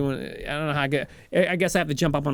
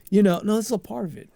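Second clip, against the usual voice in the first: ordinary talk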